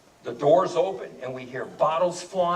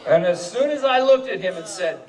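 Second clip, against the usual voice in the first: usual speaking voice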